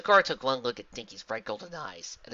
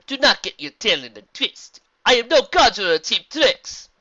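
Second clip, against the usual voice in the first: loud, raised zebra voice; indignant shout; 10 semitones higher, 13 dB louder than usual. A narrator's reading. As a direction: snap back, rising in volume. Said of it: "Sakura shouted, s-, raising her voice".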